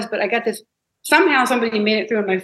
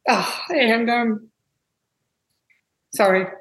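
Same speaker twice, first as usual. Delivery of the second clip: halting, tearful pause, real; fighting back real tears; choked up; 2 semitones higher, slower than usual. Real, not acted. Overcome while telling the runner's story, tearful. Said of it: "Let me get all emotional".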